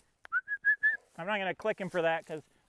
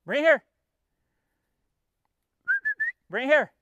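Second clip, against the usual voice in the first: loud call; 10 semitones higher, 8 dB louder than usual. Real, not acted. Owner calling his dog back from across the field, loud high call.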